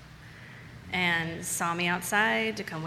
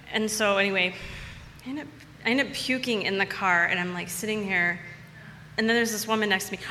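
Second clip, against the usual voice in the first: ordinary talk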